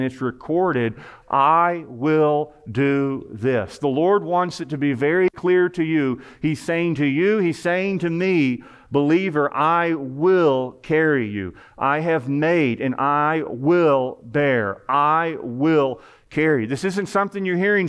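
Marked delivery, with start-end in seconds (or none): 1.3-3.8 each word of "I will do this" drawn out very slowly and weighted; emphatic. Retold or imitated. Preacher voices the Lord's own promise, "I will do this" slow and emphatic. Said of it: "the Lord himself is emphasizing". 8.9-16.6 slow, weighted stress on every "I will"; emphatic. Retold or imitated. Preacher voices God assuring the believer, each "I will" slow and emphatic. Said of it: "in an emphatic way".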